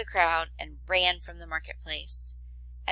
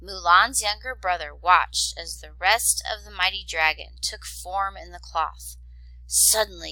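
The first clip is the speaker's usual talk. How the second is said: ordinary delivery, nothing marked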